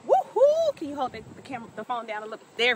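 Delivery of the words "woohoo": high whoop of joy; excited cheer. Real, not acted. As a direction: cheer it out, thrilled. Celebrating finishing her walk.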